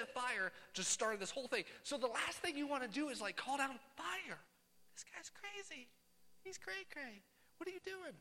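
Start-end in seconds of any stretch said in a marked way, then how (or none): 4.9-8.1 high, soft put-on voices of baffled onlookers; mocking onlookers. Onlookers gossiping about the man calling down fire, mocking and hushed. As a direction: quietly squeak it like a muttering bystander.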